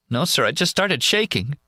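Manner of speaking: tearful. Acted. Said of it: "the sobbing starts again".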